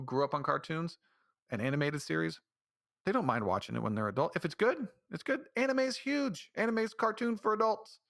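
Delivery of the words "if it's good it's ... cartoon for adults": high, insistent rush of excitement; excited. Real, not acted. Vlogger defending adult cartoons, excited and high. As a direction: push the point up high, fired up.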